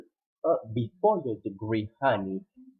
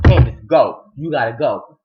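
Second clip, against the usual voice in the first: loud, urgent send-off; sudden loud dismissal; retold shouted order; 13 dB louder than usual. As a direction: act out ordering someone out. Acting son ordering visitor out.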